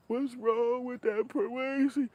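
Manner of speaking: put-on very high, slow child's wail; shrill kid. Child asking what's wrong with the man, high wail. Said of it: "And the kid be like".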